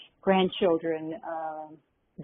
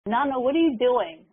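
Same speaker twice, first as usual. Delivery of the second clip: raised-pitch, louder mock child's cry; lightly voiced upset child, higher and louder; imitating screaming granddaughter; 6 semitones higher, 5 dB louder than usual. Granddaughter breaking off her tantrum to question Nana, mock screaming. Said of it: "who was screaming, and all of a sudden said".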